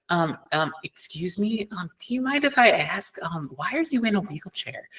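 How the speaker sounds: high, soft, tiptoeing question; adult politely asking a wheelchair user, far too softly; overly soft polite adult. Retold or imitated. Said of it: "too softly almost".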